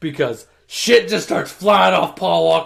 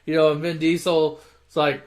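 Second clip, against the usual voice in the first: usual speaking voice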